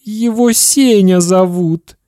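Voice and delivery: put-on slow, high, tearful child; crying boy. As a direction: answer through acted tears. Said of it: "и заплакал".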